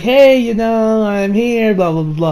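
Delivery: high, drawn-out sing-song greeting; maid calling out. Retold or imitated. Maid knocking on the client's door, high drawn-out call. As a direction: imitate a chirpy maid at the door.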